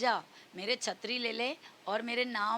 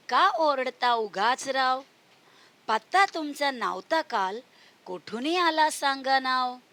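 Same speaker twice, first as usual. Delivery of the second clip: put-on sing-song, louder recital of a children's poem; poem recitation; 2 semitones higher, 5 dB louder than usual. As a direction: recite the verse loudly for kids. (बच्चों को कविता सुनाते हुए, ज़ोर से गाकर पढ़ना)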